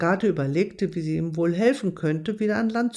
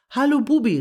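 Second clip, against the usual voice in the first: higher, louder call up to a friend in a tree; 7 semitones higher, 5 dB louder, faster than usual. A narrator's reading. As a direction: call up cheerfully to Bubi. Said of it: "rief Agathe deshalb".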